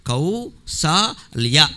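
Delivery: కౌసల్య broken slowly into three syllables. Shown as a demonstration. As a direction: say కౌసల్య as cow... saa... lya. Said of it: "పిల్లాడికి చాలా కష్టం అండి".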